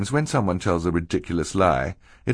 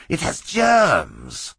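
very slow, high, stretched-out villain cry; drawn-out theatrical protest; 10 semitones higher, much slower than usual. A narrator's reading. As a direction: wail the excuse in a put-on high voice. Villain protests about the germs.

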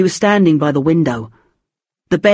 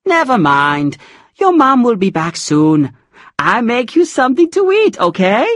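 gentle comforting; 6 semitones higher than usual. A narrator's reading. Puri comforting child.

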